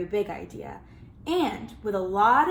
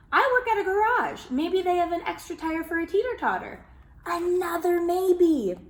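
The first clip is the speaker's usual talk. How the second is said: higher, brighter neighbor voice; helpful neighbor; 8 semitones higher, 4 dB louder than usual. Acted. Miss Lopez offering a tire to the kids, eagerly.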